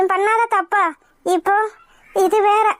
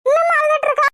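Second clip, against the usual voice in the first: shocked; 7 semitones higher than usual. Acted. Shocked the bride still crying.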